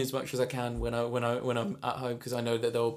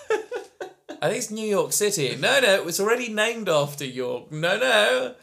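pompous settler voice; 6 semitones higher, 4 dB louder, slower than usual. Pretend. Playing a colonial settler naming land.

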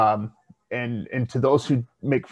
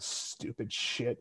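muttered; 11 dB quieter, much slower than usual. Real, not acted. Muttered under breath.

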